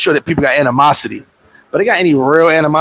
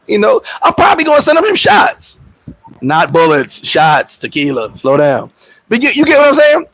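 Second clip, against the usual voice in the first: ordinary talk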